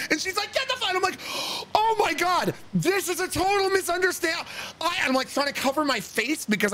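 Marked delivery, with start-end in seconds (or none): 0.5-0.9 high mock scream, cut off; frightened shriek. 1.7-4.4 high-pitched frantic explaining; panicked. Acted. Panicked apology to frightened stranger.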